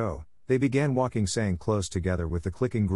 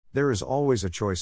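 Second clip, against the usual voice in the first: ordinary delivery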